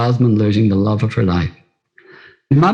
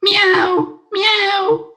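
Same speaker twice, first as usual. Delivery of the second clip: high little kitten mewing, much higher; kitten meow. A narrator's reading. Kitten answering Brona, high mewing meows. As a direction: mew like a tiny kitten. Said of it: "And the little kitten said".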